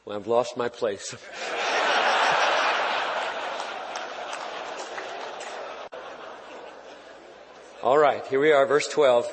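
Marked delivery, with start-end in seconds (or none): 1.2-6.3 helpless, soft, breathless laugh; real laughing fit. Real, not acted. Pastor cracking himself up at his own joke, soft laughing fit. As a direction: let the laugh take over.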